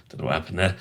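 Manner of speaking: usual speaking voice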